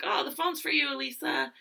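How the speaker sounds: high-pitched mom calling voice; imitated mom. Mom calling daughter to the phone, high imitated voice. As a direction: lightly mimic a mom calling her kid. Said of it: "and my mom's like".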